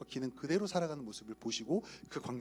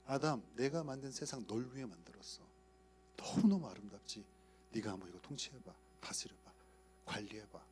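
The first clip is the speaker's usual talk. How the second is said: softer, lower and gentle; tender fatherly voice; 5 semitones lower, 8 dB quieter than usual. Retold or imitated. God gently giving Adam his world, soft and tender. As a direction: hand it over like a loving father.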